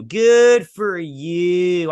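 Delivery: slow, drawn-out sarcastic good for you; sarcastic drawl. Shown as a demonstration. Reviewer to fans who liked the finale, in a slow, sarcastic drawl. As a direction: stretch it out, dripping with sarcasm. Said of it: "But if you did".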